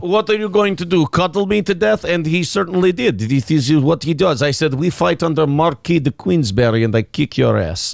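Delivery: comic Russian fighter telling his tale; Russian accent. Acted. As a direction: put on a mock Russian accent. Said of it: "you can do a Russian accent".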